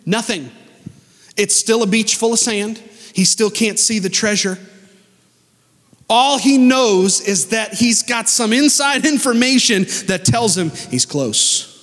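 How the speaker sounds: fervent, lifted sermon voice over music; preaching. Acted. Pastor preaching to the congregation, full fervor.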